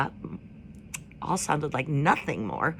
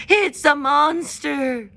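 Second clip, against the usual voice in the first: higher, louder, slow alarmed screech; screeching owl; 9 semitones higher, 7 dB louder, much slower than usual. A narrator's reading. Owl screeching at the robot, higher and louder. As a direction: shriek it in alarm. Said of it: "fluttered from his branch and screeched".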